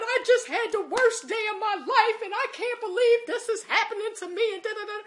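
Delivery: acted high-pitched, loud wailing; retold blubbering complaint, kept way up high; bursting out crying. Shopper pouring out worst day to stranger, wailing. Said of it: "I bust out crying".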